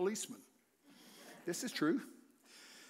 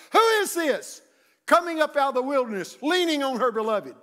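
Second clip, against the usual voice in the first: higher, louder declaimed verse; loud scripture proclamation; 9 semitones higher, 10 dB louder than usual. Acted. Preacher proclaiming Song of Solomon, loud declaiming. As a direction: ring the verse out to the church.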